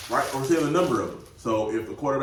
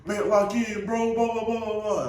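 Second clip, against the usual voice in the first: higher, lightly mocking teammate nagging; scolding teammate; 11 semitones higher than usual. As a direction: nag like an annoyed teammate. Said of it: "my teammates like".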